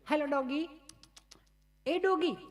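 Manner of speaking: lady calling sweetly to the dog. Retold or imitated. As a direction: coax the dog in a high voice.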